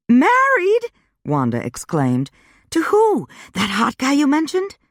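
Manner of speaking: put-on high, excited girlfriend voice; a bubbly friend bursting with questions; Wanda voiced high. A narrator's reading.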